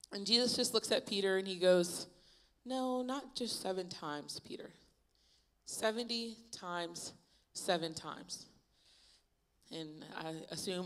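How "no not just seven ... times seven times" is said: slow, soft, spaced-out answer; hushed, unhurried teacher's reply; measured correction. Acted. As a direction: let each word land quietly and deliberately. Acting Jesus's measured answer to Peter.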